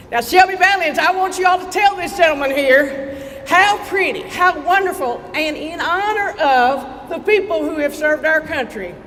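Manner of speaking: rally shout. Real, not acted. Urging Shelby Valley crowd to cheer for flag, rousing shout. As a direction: shout it out, get them cheering.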